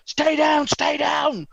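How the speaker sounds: high, loud barked command; shouting orders. Acted. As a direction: yell it as a forceful order. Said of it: "And shouting orders".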